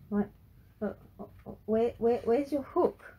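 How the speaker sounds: scared stutter. A narrator's reading. Frankie asks about granddad's hook, stuttering. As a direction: stumble over the words in shock. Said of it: "he stuttered".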